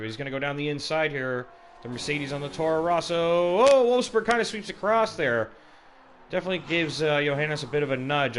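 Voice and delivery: building to a high loud peak; excited play-by-play. Real, not acted. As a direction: swell up as the cars touch. Contact called live.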